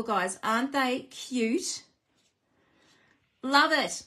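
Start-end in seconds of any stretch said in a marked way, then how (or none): 3.4-4.1 bursts into a real laugh, higher and louder; a bright real laugh; laughing delight. Real, not acted. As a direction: giggle with delight at the cute cows. Delighted with her cow box.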